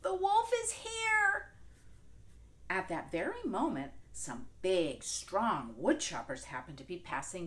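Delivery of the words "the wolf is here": much higher, louder, slow frightened shout; panicked cry. Acted. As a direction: scream to be rescued. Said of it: "she called".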